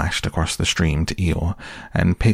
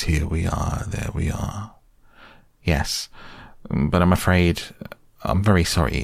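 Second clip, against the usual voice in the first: ordinary delivery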